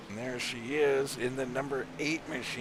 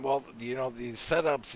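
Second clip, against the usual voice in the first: ordinary talk, nothing marked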